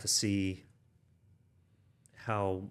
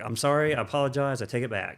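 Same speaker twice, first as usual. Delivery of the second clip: hasty mock apology; 4 semitones higher, 8 dB louder than usual.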